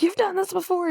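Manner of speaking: high-pitched retold panic; mock panic, pitched right up; freaking out. Co-host retelling her frightened line, mock freaking out. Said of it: "freaking out".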